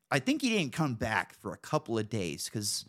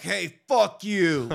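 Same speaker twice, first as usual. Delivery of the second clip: slow, loud, higher put-on bum voice; homeless guy voice; 8 semitones higher, 6 dB louder, much slower than usual. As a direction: drag it out like a surly drunk. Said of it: "he was just like".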